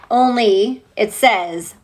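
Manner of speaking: usual speaking voice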